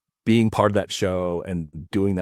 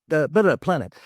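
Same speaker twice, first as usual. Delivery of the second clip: lightly imitated starship captain, raised and louder; William Shatner; 8 semitones higher, 5 dB louder than usual. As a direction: give a higher, louder captain's order. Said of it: "Shatner's like".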